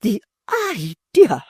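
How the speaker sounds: high, loud indignant matron; a wounded lady turning cold and grand; high, loud offended dowager; Missus Chick, outraged. A narrator's reading.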